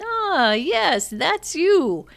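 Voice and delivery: high, playful officer's quip. Retold or imitated. Officer jokes after checking passport.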